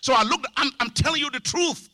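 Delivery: ordinary talk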